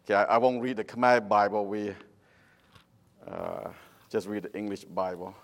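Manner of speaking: Cambodian-accented English. Real, not acted. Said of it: "you can say Cambodian English".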